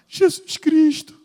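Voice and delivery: high, slow, strained sobbing voice; crying girl. Retold or imitated. Girl on the bus crying as she repeats, high and sobbing. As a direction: wail the name out high through tears.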